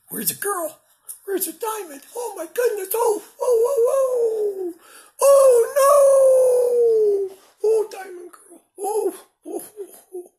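high squeaky mock panic; dog's voice. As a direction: shriek in a silly dog voice.

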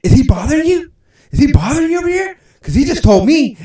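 high, loud nosy voice; hammed-up girl butting in; mock protective woman. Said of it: "she's like".

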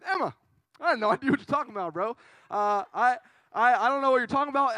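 feigned innocence. Retold or imitated. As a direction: protest too much, playing clueless. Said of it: "I lied to her".

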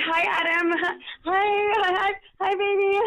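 high, giggly hellos to a fan; delighted greeting. Real, not acted. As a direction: squeal a happy hello, laughing.

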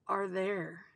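usual speaking voice

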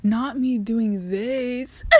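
slow laugh-crying, voice breaking; half laughing, half crying. Real, not acted. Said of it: "me losing my voice".

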